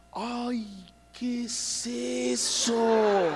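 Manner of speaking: high, slowly drawn out "¿qué es eso?"; appalled drawn-out question; disgusted recoil. Acted. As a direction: pull back from something gross. Dad disgusted at newborn.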